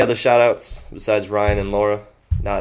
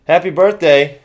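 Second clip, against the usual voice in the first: excited; 4 semitones higher, 6 dB louder than usual. Real, not acted. Birthday shoutout.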